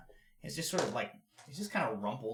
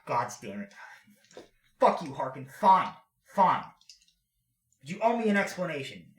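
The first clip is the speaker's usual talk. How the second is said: angry outburst; 6 semitones higher, 10 dB louder than usual. Acted. Teak forced to cover for Harkin.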